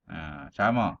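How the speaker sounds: normal talking voice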